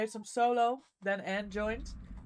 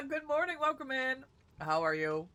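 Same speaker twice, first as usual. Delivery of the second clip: ordinary delivery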